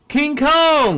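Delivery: excited. Acted. Grand monster name call.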